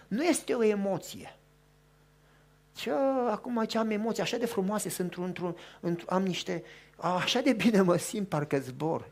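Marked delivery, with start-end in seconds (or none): 2.7-9.1 soft, dreamy, mocking swoon; lightly mock someone floating on feelings; mocking believers who trust feelings; mock dreamy emotion.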